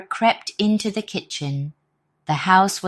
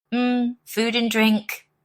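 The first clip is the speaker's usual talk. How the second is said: hungry savouring; 6 semitones higher, slower than usual. Acted. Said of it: "his stomach rumbling".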